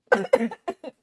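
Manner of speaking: sharp real cough. Real, not acted. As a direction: cough hard. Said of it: "Sorry, I'm, like, coughing".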